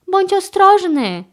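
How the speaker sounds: high, loud anxious warning; worried. A narrator's reading. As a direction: warn him with concern. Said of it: "zatroskali się Chase i Carlos".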